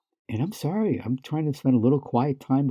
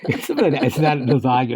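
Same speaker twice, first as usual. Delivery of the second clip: laughing; 5 semitones higher, 3 dB louder, faster than usual. Real, not acted. Laughs while retelling friend's text.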